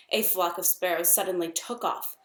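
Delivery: ordinary delivery, nothing marked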